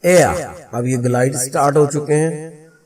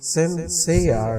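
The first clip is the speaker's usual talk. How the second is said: "Sincere" with a quick first syllable and raised, stretched "share"; slow "sincere", stressing "share"; stress on second syllable; 4 semitones higher than usual. Shown as a demonstration. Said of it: "stress यहाँ पे है". ("Sincere" में ज़ोर दूसरे हिस्से "share" पर)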